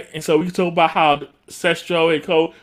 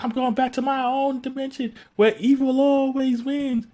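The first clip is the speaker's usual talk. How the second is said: mock villain; 11 semitones higher than usual. Voicing Master Vile leaving.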